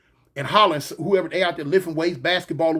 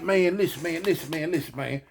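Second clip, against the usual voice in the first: hushed pleading; 3 semitones lower, 5 dB quieter than usual. Retold or imitated. Caught inmate pleading.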